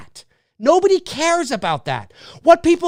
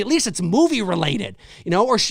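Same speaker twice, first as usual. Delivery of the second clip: ordinary talk, nothing marked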